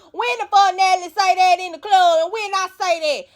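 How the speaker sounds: high put-on cussing-out voice; going off. Dolly going off on Russian and Elsie, mock angry. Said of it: "Dolly get to going out".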